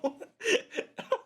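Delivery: sudden high-pitched burst of laughter. Real, not acted. Podcaster laughing at a movie joke, a high burst of laughter. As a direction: crack up high and light.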